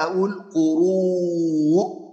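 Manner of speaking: قروء recited very slowly with a long drawn vowel and glottal stop; Quranic recitation. Shown as a demonstration. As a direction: stretch out قروء as in tajwid. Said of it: "خلي بالك معايا".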